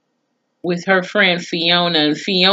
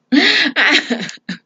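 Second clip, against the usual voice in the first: laugh; 10 semitones higher than usual. Real, not acted. Laughs after her rant.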